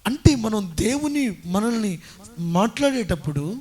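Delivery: loud, slow, rising sermon climax; preaching at full force. Acted. Preacher urging the congregation to respond to God, at full force. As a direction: preach it out, pressing each word.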